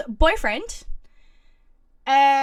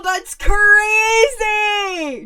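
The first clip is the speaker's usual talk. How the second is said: drawn-out, loud, higher cry of disbelief; excited shout; 8 semitones higher, 7 dB louder, much slower than usual. Real, not acted. Streamer reacting to a wild story, shouting in disbelief. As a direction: stretch it out in loud astonishment.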